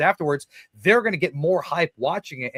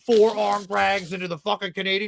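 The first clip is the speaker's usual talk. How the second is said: higher-pitched, hyped-up mock dude; mock a clueless wrestler gushing over a move; gushing bro impression; 4 semitones higher than usual. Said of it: "FaceTime each other and be like".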